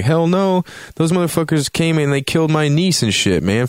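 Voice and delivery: high, heated mock outburst; indignant retort. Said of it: "And they're like".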